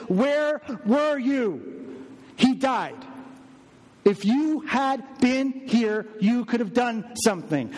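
high, pained plea in retold grief; pleading cry. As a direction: voice climbing high as a grieving sister begs. Mary screaming at Jesus.

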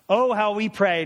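rising preacher's exclamation; exclaimed. Real, not acted. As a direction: throw it out with feeling. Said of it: "preacher exclaims".

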